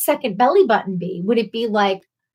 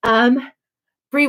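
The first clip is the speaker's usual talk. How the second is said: normal talking voice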